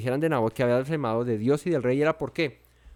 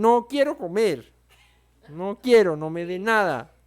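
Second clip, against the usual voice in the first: higher, drawn-out sulky refusal; tantrum child; 3 semitones higher than usual. Acted. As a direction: whine it like a pouting little kid refusing food. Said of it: "un chiquitín está con su pataleta".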